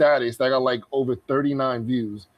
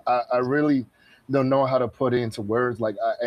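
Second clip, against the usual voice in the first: usual speaking voice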